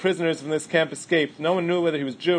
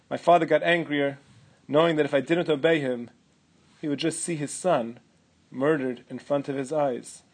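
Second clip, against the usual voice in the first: ordinary delivery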